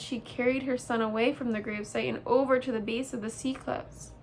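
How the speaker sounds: ordinary delivery, nothing marked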